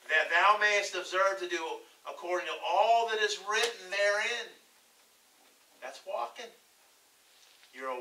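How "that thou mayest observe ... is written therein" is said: loud, lifted Bible reading; preacher declaiming scripture. Acted. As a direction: proclaim the verse to the congregation. Reads scripture aloud.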